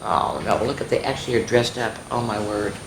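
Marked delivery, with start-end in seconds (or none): none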